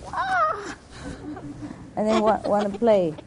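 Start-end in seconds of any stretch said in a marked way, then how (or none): 0.1-0.8 high squawking cry imitating a parrot; a sudden high screech like the excited bird; bird's squawk. Showing how the bird greets the guy she loves, with a high screech.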